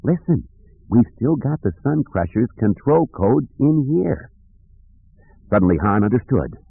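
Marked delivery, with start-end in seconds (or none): 0.0-4.3 deliberate, higher-pitched hint; measured, meaningful delivery; very slowly. A narrator's reading. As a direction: spell it out pointedly for a friend. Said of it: "spoke very slowly".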